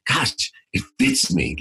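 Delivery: slow, choked-up wonder; moved almost to tears, slowly; tearful awe. Retold or imitated. Son moved by father's gift, slow and teary. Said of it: "I remember crying when I tried it on".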